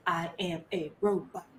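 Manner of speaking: low, soft, mechanical robot monotone. Retold or imitated. Showing up to the call as a robot. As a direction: drone it out like a robot. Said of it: "I show up like this".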